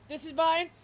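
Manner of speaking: high, loud claiming bark; a dog staking claim on everything; shouting possessive dog. Acted.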